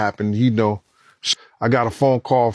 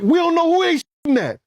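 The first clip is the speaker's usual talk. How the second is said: high, tense reply in the chaos; frantic, unsure who is hit; alarmed. Retold or imitated. Panic during shooting.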